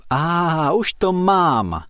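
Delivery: high, slow delighted "I've got it"; joyful eureka. A narrator's reading. The elf suddenly finds the answer and rejoices, high and slow. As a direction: savour the sudden idea. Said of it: "zaradoval se najednou skřítek".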